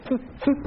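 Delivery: short puff of TH for "フッ"; English TH sound. Shown as a demonstration. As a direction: demonstrate "フッ" as the English TH consonant. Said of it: "英語だとティーエイチの発音ですね".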